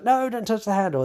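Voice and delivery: high, fast frightened plea; panicked plea. Retold or imitated. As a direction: beg him not to touch it. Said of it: "She's like".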